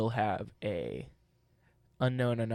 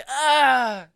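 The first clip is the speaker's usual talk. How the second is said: scream; 13 semitones higher, 14 dB louder than usual. Retold or imitated. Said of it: "they're like".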